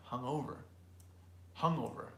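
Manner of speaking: "Hungover" stressed on the "o"; stress on second syllable. Shown as a demonstration. Teacher repeats the word, "Hungover" stressed on its second syllable. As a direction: lean on the middle: hung-O-ver. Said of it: "stresses the second".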